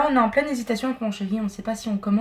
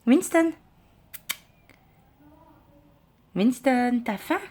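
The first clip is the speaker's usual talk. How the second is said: calling the cat; 4 semitones higher, much slower than usual. Real, not acted.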